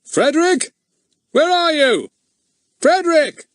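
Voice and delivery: high, loud booming call for Frederick; stentorian call. A narrator's reading. Chief Inspector calls for Fatty, a stentorian shout. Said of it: "a most stentorian voice".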